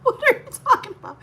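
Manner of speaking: high, quick, laughing disbelief; baffled laughing reply. Retold or imitated. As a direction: laugh it off in total confusion. Baffled reply in retold fight.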